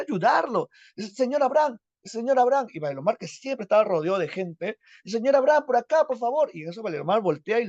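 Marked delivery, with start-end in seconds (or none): 1.0-2.7 high calling voice, pitched way up. Acted. Vallejo llama a Valdelomar. 5.0-6.5 high, louder calling out. Acted. Vallejo llama a Valdelomar.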